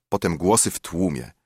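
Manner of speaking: ordinary delivery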